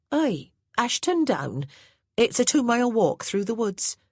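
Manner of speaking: put-on a plain-spoken old housemaid reporting orders; Hattie the maid's voice. A narrator's reading.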